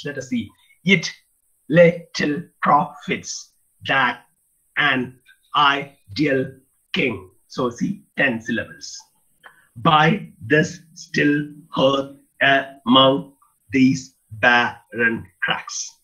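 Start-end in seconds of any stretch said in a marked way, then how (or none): 0.8-7.3 slow, each syllable spaced out evenly; scanning syllables. Shown as a demonstration. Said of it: "That every line has ten syllables". 9.8-15.9 slow, each syllable spaced out evenly; scanning syllables. Shown as a demonstration. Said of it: "So ten syllables".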